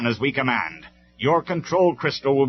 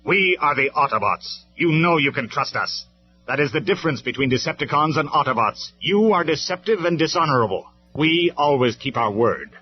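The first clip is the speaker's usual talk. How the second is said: harsh; 8 semitones higher, 9 dB louder than usual. Acted. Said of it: "Optimus Prime's voice was harsh".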